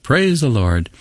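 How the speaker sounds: high cry of praise, falling away; mock shout, lifted in pitch; retold shout. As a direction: imitate a worshipper's shout at moderate volume. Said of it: "she shouted".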